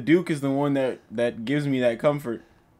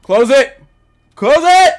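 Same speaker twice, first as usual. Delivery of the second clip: high loud cry rising to a scream; frightened command climbing into a shriek; panicked shout, building; 10 semitones higher, 11 dB louder, slower than usual. Real, not acted. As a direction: yell to shut it, panic mounting. Panic, shutting the door.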